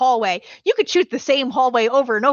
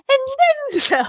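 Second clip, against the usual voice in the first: Princess Donut voice; much faster than usual. Retold or imitated. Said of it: "mannerisms he does as Princess Donut".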